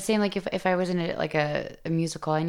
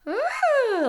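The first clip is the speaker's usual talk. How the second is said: very high ooh in head voice; 5 dB louder than usual. Shown as a demonstration. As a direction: sing an ooh at the top. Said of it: "So, like, the".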